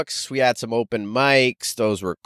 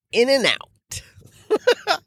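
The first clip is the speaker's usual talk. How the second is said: very slow, much higher, stretched out; teasing drawl; 14 semitones higher, much slower than usual. Pretend. Joking to viewers, drawn out very slow and high. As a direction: drag it out with a smirk. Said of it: "the cheeriness in my tone".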